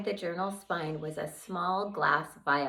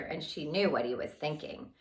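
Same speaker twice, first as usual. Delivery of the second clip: plain reading voice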